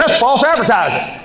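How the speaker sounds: high-pitched mock outrage; indignant complaint. Woman complains about the clear paper, mock indignant.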